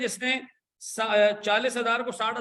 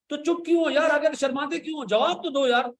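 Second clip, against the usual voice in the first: exasperated prodding; 8 semitones higher, 3 dB louder than usual. Real, not acted. Teacher demands answers from silent class.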